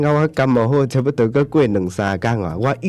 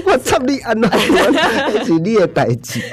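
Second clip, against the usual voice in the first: laughing; 10 semitones higher than usual. Real, not acted. Laughing at partner's flustered question.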